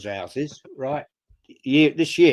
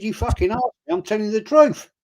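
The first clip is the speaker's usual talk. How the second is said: higher, mock-exasperated telling off; retelling a row with his daughter, indignant and raised; retold indignant outburst; 9 semitones higher, 4 dB louder, faster than usual. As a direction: replay the row, pitched up and indignant.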